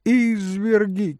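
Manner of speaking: high, drawn-out wail of outrage; lady's high-pitched outcry; crying out. A narrator's reading. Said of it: "кричала дама". (высокий протяжный крик дамы)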